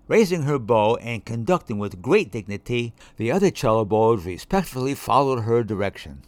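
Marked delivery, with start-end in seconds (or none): none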